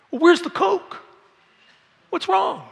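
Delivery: hammed-up high demand for a Coke; rude demand. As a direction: squeal it like a spoiled spouse. Mock spouse dropping manners.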